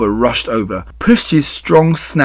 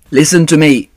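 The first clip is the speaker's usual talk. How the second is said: loud, higher plea; urgent cry; 5 semitones higher, 8 dB louder than usual. A narrator's reading. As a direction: cry out for attention. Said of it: "cried Wilbur".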